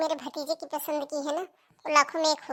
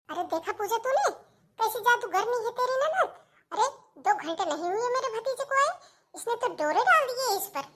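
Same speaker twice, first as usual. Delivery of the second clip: high-pitched indignant accusation; angry; 7 semitones higher than usual. Acted. Aunt accusing the sister-in-law of trapping her nephew, angry. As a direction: complain angrily, pitch climbing. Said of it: "आप इतना गुस्सा क्यों कर रहे हो दीदी".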